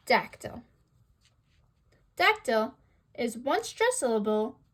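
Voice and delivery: ordinary delivery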